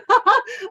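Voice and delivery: a bright burst of real laughter; excited laugh. Real, not acted. Speaker laughs to the team about her passion, excited laugh. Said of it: "I'm so passionate".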